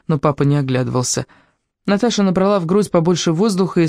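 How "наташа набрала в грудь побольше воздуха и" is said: ordinary delivery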